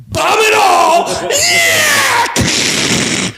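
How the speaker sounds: very high, drawn-out gleeful yell; hammed-up screaming cheer; mock war cry. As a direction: shriek it like a crazed bomber. Repeats gleeful bomber.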